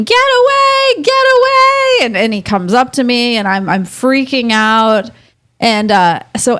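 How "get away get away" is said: high, drawn-out imitation of a screaming mom; retold mom's scream. Woman retelling her mom fending off Chucky, high mock scream. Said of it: "My mom is screaming".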